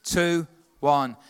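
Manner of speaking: ordinary delivery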